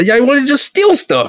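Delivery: high whiny voice. Pretend. Mock whiny gang applicant.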